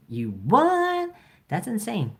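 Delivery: real startled outburst; shocked. Real, not acted. Reacting to YouTube warning.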